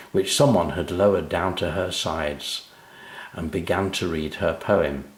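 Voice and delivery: ordinary delivery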